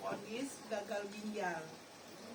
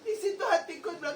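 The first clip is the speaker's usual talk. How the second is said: laugh-cry; 6 semitones higher, 6 dB louder, faster than usual. Real, not acted.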